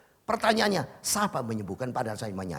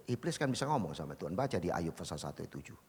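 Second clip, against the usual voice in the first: usual speaking voice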